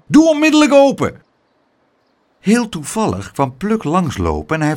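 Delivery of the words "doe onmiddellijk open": high, loud demand held at full pitch; furious shout. A narrator's reading. Angry crowd demanding entry at the door, furious shout. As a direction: call out angrily to get the door opened. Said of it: "riepen ze".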